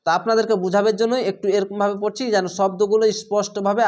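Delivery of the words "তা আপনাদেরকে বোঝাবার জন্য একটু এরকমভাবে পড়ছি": ordinary talk, nothing marked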